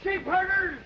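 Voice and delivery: high, furious shouting of insults; angry tirade. Acted. Grandpa yelling at train gamblers who cheated him, furious. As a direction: yell each insult like a swindled cowboy.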